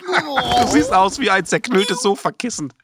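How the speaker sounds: laughing. Acted. Laughing at Ole's lemon face.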